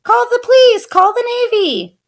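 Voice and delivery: high, loud alarm; panicked shouting. A narrator's reading. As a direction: yell for help in a panic. Coach panicking.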